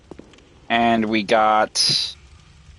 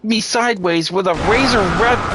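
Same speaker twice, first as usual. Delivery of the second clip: exasperated ranting; 9 semitones higher than usual. Real, not acted.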